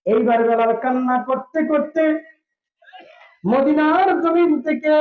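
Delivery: high, chanted sermon narration; melodic preaching. A narrator's reading. Preacher telling of Bilal leaving Medina in tears, high melodic preaching.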